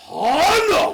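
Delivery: high, loud mock wail; hammed-up cry of dismay; acted Chinese man. Voicing the Chinese man discovering trouble, mock wail. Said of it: "the Chinese man's like".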